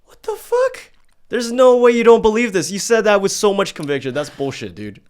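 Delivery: loud, high-pitched incredulous outburst; real disbelief. Real, not acted. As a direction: burst out in shocked disbelief, voice shooting up. Host disbelieves her denial.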